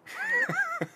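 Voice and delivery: high-pitched burst of laughter. Real, not acted. Laughs at own story.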